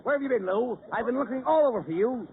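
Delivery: usual speaking voice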